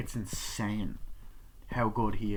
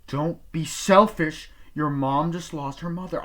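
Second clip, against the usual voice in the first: raised, slowed father's scold; stern scolding; 7 semitones higher, 6 dB louder than usual. Retold or imitated. Father scolding son over film.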